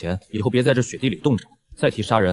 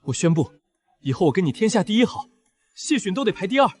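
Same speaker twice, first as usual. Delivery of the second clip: excited; 8 semitones higher than usual. Acted. Overjoyed declaration to maid.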